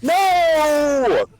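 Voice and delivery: high, drawn-out mock wail of refusal; mock anguish. Drummer refusing the song offer, mock anguish. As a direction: wail it in exaggerated horror.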